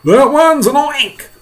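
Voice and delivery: high, drawn-out scream of alarm. Acted. Fire chief spotting the grasshopper, alarmed scream. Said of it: "screamed the head of the fire department".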